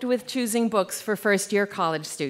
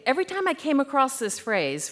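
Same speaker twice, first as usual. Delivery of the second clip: ordinary talk, nothing marked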